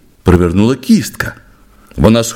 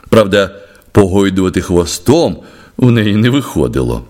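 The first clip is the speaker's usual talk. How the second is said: usual speaking voice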